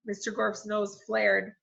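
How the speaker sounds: usual speaking voice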